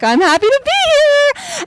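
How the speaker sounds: high, silly, gleeful squeal; joking. Retold or imitated. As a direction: squeal it playfully, nudging a stranger. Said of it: "kinda joking and nudging with him".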